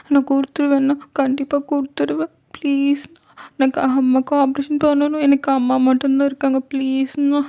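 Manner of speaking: put-on high, tearful girl's desperate pleading; pleading young girl. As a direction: plead for her sick mother in a high, teary voice. (அம்மாவுக்காக கெஞ்சும் சிறுமி குரல்)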